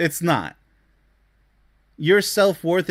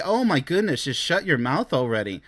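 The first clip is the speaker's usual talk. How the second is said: normal talking voice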